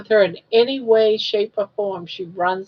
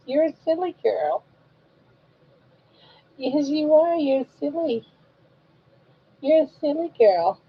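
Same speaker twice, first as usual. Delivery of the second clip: sing-song coaxing, pitch rising toward the end; lightly lilting mama voice; baby talk; 3 semitones higher than usual. Acted. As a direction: coo at the baby to win a smile.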